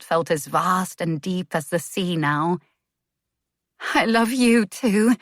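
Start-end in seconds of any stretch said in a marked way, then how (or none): 3.9-5.1 higher, louder, a touch of tremble; barely held-together confession of love; trembling voice. Acted. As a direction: let the words shake with feeling. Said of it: "Her voice was trembling".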